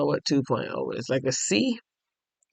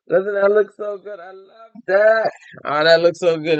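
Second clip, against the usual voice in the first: higher, louder gushing; delighted admiration; 5 semitones higher, 4 dB louder than usual. Real, not acted. Knitter showing viewers a pattern she loves, delighted. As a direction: gush with real delight.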